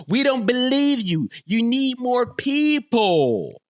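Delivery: high, loud drawn-out jeer; taunting crowd-style heckle, high and loud; mocking catchphrase. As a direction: call it out big, stretching the last word. Quoting a mocking catchphrase at the top.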